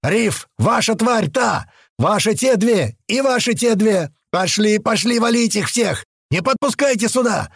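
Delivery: high, urgent battlefield commands; shouted orders. A narrator's reading. Туман dividing targets among his men, shouting orders. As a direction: fire off each order as a high holler. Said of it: "Это он снова крикнул нам".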